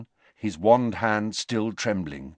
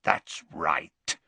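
Greyback rasp; 4 semitones lower than usual. A narrator's reading. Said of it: "said Greyback".

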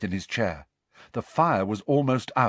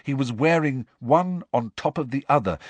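plain reading voice

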